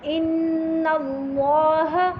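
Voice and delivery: slow measured Quranic reading; recitation. Shown as a demonstration. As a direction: recite slowly and clearly.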